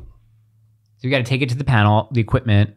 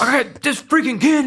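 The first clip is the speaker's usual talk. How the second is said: high, strained mock yell; losing it half-asleep; 13 semitones higher than usual. Imitating Carly's impression of him trying to sleep, cranky mock yell. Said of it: "Carly does a really good impression of me".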